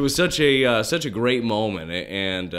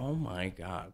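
awed hush; 3 semitones lower, 13 dB quieter, slower than usual. Retold or imitated. Awed entering big league clubhouse.

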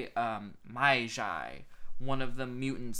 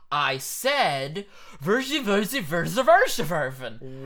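louder, higher mock-German gibberish; Brando's insistent nonsense; 4 semitones higher, 8 dB louder, slower than usual. Actor repeating himself to confused German, emphatic babble. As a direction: repeat the gibberish forcefully, as if to someone slow. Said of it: "And then Brando is like".

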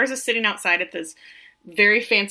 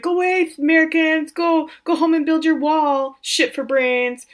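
higher, louder hammed-up rant at tourists; mock angry shout; 8 semitones higher, 5 dB louder than usual. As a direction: bark it like a cranky local chasing tourists off. [name] yelling at American tourists.

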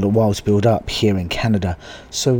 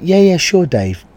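forced eager yes; 9 semitones higher, 6 dB louder than usual. Retold or imitated. Nervous fake agreement retold.

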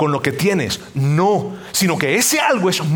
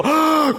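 high scandalized "Ah!"; mock gasp; 9 semitones higher than usual. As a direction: gasp like an offended onlooker. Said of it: "murmurando y diciendo". (grito ahogado de escándalo)

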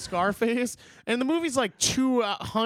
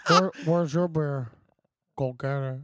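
Al Pacino; 4 semitones lower than usual. Retold or imitated. Friend repeating the movie line for laughs, Scarface impression. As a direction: drag it out like Scarface. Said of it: "your, uh, Pacino impression of".

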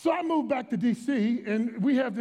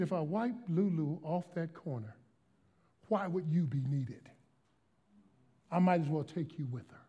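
low, measured, quieter rebuke; Holy Spirit; 5 semitones lower, 5 dB quieter than usual. Retold or imitated. God rebuking the pastor, retold in a lowered voice. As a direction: deliver a sobering rebuke, low and unhurried. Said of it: "suddenly the Holy Spirit".

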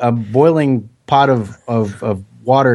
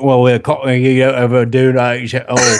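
attempted Australian sliding into Cockney; bad Cockney accent. Shown as a demonstration. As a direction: fumble through a dodgy Cockney accent.

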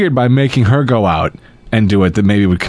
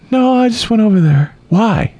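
mock wife voice; 9 semitones higher than usual. Quoting Becky, higher.

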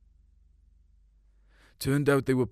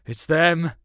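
alarmed cry; 6 semitones higher, 6 dB louder than usual. Retold or imitated. Boy finds the bodies.

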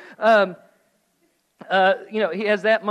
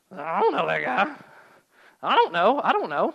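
hammed-up disowning, pitched up; mock denial. Peter denying he knows Jesus, mock evasive. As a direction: blurt a cartoonish, evasive denial.

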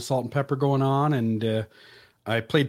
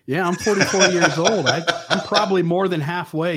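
laughing; 4 semitones higher, 4 dB louder than usual. Real, not acted. Laughing about age.

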